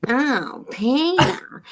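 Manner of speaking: a whiny cartoon mom calling out; lightly done Lois voice; Lois impression. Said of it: "It sounded just like Lois".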